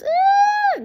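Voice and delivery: shrill, sustained squeal imitating someone losing it; one long, very high, loud "eeee" in imitation; mock high-pitched squeal. Imitating the woman who goes off the rails, mock high squeal. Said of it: "goes off the rails".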